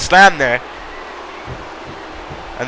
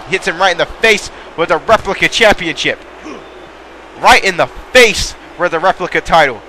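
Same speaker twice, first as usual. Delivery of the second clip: ordinary talk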